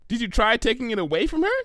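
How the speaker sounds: high, fast, eager pony voice; bubbly Pinky. Acted. Pinky suggesting to Twilight, bubbly and quick. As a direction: blurt out a silly idea brightly.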